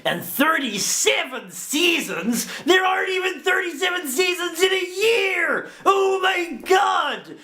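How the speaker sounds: squealing, high-pitched mock outrage. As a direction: wail it like it's a scandal. Outraged at the season count.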